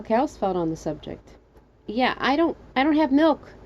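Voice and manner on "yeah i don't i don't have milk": high, hesitant call; mild call up from below. Acted. Trapped narrator to the stranger, calling out. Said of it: "I called out in my echoing voice".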